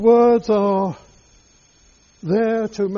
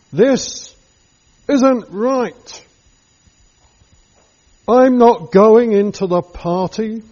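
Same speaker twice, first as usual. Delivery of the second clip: rising anger; 5 dB louder than usual. Acted. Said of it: "you feel great anger rising up".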